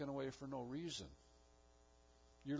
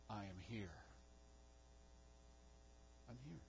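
soft hushed assurance; 5 semitones lower, 17 dB quieter than usual. Acted. God's assurance whispered softly.

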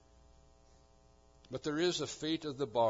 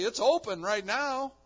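high, louder pressing question; urgent challenge; 11 semitones higher, 7 dB louder than usual. Acted. Commentator challenging the idle, urgent raised voice. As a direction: push the listener to act now. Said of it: "And he says".

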